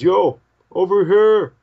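high, loud drawn-out holler; long shouted call. Acted. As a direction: hail someone from a distance. Stranger calls out to them.

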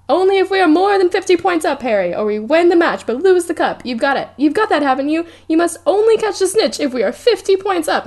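high, loud nagging coach voice; pushy captain Wood. Retold or imitated. Retelling Wood pressuring Harry before the final, high and nagging. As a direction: drill it into him, anxious.

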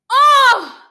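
sudden high loud gasp of fright; a short shocked cry; startled cry. Acted. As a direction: yelp as the blow lands. Child cries out in danger.

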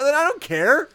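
loud, high, fed-up outburst; exasperated mock rant. Viewer ranting at a rich movie dad, mock exasperation. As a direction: throw up a loud, dismissive rant.

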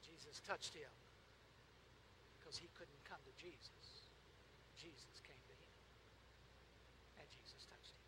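very low, soft and spaced out; reverent, barely voiced pulpit hush; hushed preaching. Real, not acted. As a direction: let each phrase sink into a near silence.

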